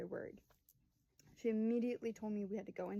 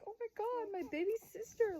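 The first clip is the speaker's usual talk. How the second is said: high, louder overwhelmed exclamation; re-enacted high-pitched emotion; imitated emotional gushing; 12 semitones higher, 4 dB louder than usual. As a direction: gush as the stunned big sister. Acts crying sister's emotional reaction.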